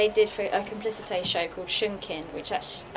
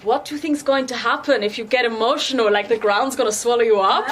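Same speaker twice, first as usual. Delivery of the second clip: loud, higher mock German teacher voice; German drama teacher; 5 semitones higher, 9 dB louder than usual. German drama teacher challenging melodrama students, loud, high German accent. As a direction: imitate a German teacher challenging his class, pitched up and louder. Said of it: "he's German".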